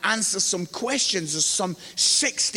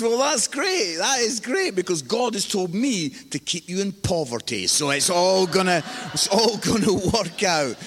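mock-cheerful sarcasm. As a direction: imitate a dad biting back at his son. Father's sarcastic comeback to son.

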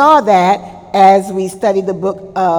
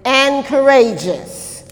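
loud, high, then slowing; emphatic quoted command; 4 semitones higher, 4 dB louder, much slower than usual. Retold or imitated. Preacher quotes the Lord's command to Joshua, emphatic proclamation. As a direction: proclaim it as a rousing charge.

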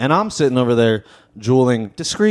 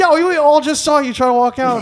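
very high, incredulous mock protest; pitched-up imitation of astonished onlookers; incredulous call-out; 13 semitones higher, 3 dB louder than usual. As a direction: call him out in a squeaky-high disbelieving voice. Bystanders call out the hypocrisy.

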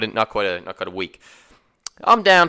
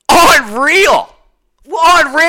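high, loud amazed unreal; stunned disbelief; 12 semitones higher, 7 dB louder, much slower than usual. Real, not acted. The narrator reacting to the drunk on the ATV, high and loud. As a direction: exclaim it twice in disbelief.

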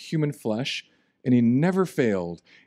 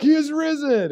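high, bright mock proclamation of he is risen; pastor's proclamation; 13 semitones higher, 3 dB louder than usual. Imagined churchgoer at First Baptist, mock proclaiming. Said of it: "someone's gonna be like".

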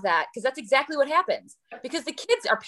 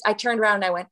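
ordinary delivery

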